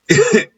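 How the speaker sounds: high burst of laughter. Real, not acted. Commentator laughs at their fate.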